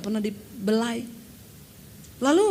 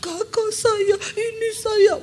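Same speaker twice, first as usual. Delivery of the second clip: high, sobbing words; crying; 9 semitones higher, much slower than usual. Retold or imitated. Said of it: "Dia mulai cerita". (suara tinggi menangis)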